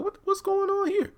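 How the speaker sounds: very high falsetto question; mock puzzled. Ruth and Tally wondering what happened, mock puzzled. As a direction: ask it in a put-on high voice.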